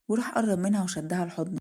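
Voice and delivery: ordinary delivery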